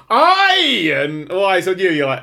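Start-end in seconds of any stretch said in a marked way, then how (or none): 0.1-0.9 high, booming imitation; sudden loud interjection. Mimicking co-host cutting in on the microphone, loud high blurt. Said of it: "You just said".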